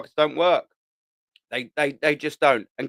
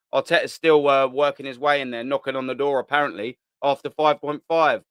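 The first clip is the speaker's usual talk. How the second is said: ordinary talk, nothing marked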